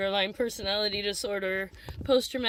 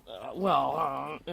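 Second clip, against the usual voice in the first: mimicked stumped counselor; 9 semitones lower, slower than usual. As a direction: imitate someone lost for an answer.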